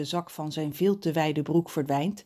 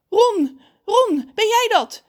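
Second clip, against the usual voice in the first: high, loud, breaking out on the name; urgent cry; 10 dB louder, slower than usual. A narrator's reading. Mother answers her missing son's call, crying out. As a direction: cry out in disbelief.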